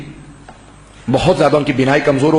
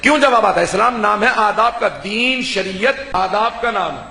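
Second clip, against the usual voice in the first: preaching; 5 semitones higher than usual. Acted. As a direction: preach it to the gathering with full fervour. (بلند آواز میں پرجوش خطابت)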